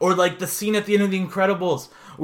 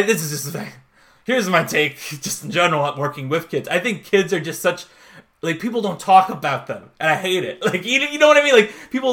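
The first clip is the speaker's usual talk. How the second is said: ordinary delivery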